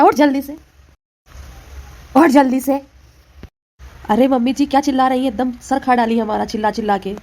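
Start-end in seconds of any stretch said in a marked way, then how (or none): 0.0-0.6 louder, higher shout to wake her. Acted. Mother-in-law shouting at the sleeping bride, louder. 2.2-2.8 much louder, higher yell; louder shout. Acted. Mother-in-law yelling again at the sleeping bride, much louder.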